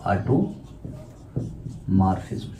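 unvoiced slow whisper; whispered dictation. Shown as a demonstration. Teacher whispering the term as students write. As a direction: breathe automorphism out. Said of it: "dictating term".

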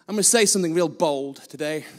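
ordinary talk

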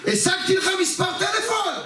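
high-pitched tipsy friend's yell; צעקה לפני כולם. Retold or imitated. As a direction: announce it publicly in a high, strained holler. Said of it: "וצועק לפני כולם". (צועק בקול גבוה מול כולם)

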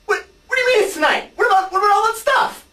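high, loud outraged disbelief; can't believe what you hear; shocked disbelief. Acted. Partner learns match is tonight.

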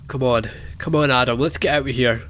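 ordinary talk

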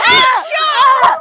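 startled shout. Real, not acted. Sudden loud noise.

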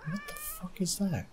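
high, soft startled question. Real, not acted. Gamer spotting something odd in the log, startled. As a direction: blurt it out, thrown. Sees something strange in game.

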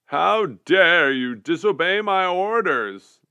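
high, slow, loud fury; angry shout. A narrator's reading. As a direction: roar with outrage at disobedience. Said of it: "the general shouted".

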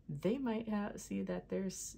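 usual speaking voice

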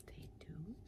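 unvoiced conspiratorial whisper; hushed narrator whisper; whispered aside. A narrator's reading. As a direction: confide it to the listener.

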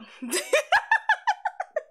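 high, loud giggling fit; real burst of laughter. Real, not acted. Streamer after doing the catboy voice, high loud laughter. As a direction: crack up in a high peal.

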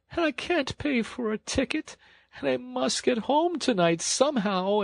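very high, hammed-up weeping washerwoman; tearful wailing. A narrator's reading. Poor washerwoman begging sympathy from engine driver, tearful wailing. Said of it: "crying afresh".

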